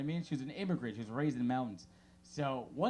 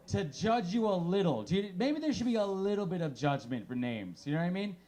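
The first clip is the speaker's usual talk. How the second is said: usual speaking voice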